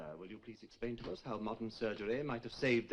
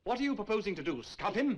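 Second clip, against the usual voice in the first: raised, higher incredulous question; loud ridicule; 7 semitones higher, 5 dB louder than usual. Acted. Lecturer mocking a student's answer before the class, loud ridicule. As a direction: throw it out loudly as mockery for the class.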